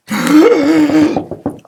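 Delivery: loud high startled gasp; a big mock-shocked gasp for the kids; dramatic gasp. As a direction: gasp as if something jumped out. Dramatic reveal of seal.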